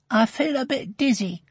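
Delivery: a hint of a woozy little girl; lightly voiced dazed girl. A narrator's reading. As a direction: voice a hurt child gently. Hurt girl in narrated story.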